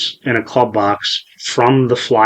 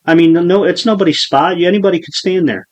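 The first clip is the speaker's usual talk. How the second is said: higher-pitched, placating protest; imitated calming bystander; 7 semitones higher than usual. Retelling a bystander trying to calm him down, higher and placating. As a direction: reason with an upset kid, voice up. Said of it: "And he's like".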